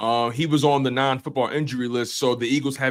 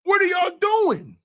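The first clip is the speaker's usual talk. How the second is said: exasperated shout. Real, not acted. Streamer ranting at Cowboys fans, exasperated high shout. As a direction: yell it in disbelief.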